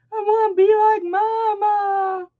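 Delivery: put-on high little-kid voice; toddler voice. Mom repeating her toddler son's words, childlike. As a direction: squeak it like a small child. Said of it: "He's like".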